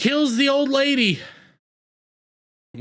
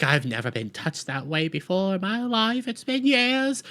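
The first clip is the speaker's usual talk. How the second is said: old grandma impression; 2 semitones higher, 3 dB quieter than usual. Grandma moved by the piano music, hammed-up old lady voice. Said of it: "grandma's like".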